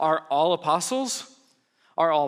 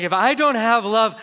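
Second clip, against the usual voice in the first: louder, higher, slower on I am nothing; emphatic; 5 semitones higher, 7 dB louder than usual. Shown as a demonstration. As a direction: drive home I am nothing. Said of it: "That's a pretty strong statement".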